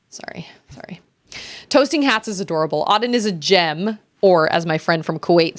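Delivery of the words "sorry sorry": very soft, voiceless whisper; hushed little whisper; whispered apology. Real, not acted. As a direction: apologize under your breath.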